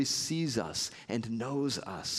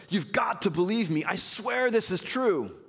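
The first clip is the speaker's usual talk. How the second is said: pleading oath; 7 semitones higher than usual. Retold or imitated. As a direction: swear it's true, begging them to believe. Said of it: "Peter swears an oath".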